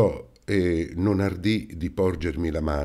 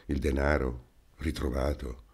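stunned disbelief; 4 semitones lower, 6 dB quieter than usual. A narrator's reading. Landlady stunned at the news.

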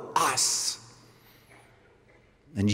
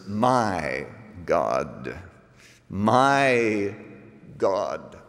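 "my" drawn out, then a pause before "God"; stress on "my"; 6 semitones lower, much slower than usual. Shown as a demonstration. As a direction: hold "my", let "God" fall low. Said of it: "Notice the words".

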